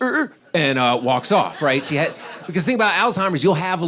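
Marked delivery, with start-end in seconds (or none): 0.0-0.3 high, short wordless "er"; imitated vacant grunt. Mom with Alzheimer's after the neighbor's insult, high imitated grunt. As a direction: squeak out a confused mock noise. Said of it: "I see my mom like".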